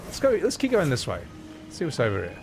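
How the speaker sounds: ordinary delivery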